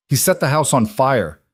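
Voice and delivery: hurry, it's burning; urgent. A narrator's reading. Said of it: "Tom said urgently".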